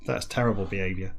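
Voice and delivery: ordinary talk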